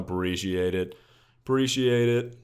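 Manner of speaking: ordinary talk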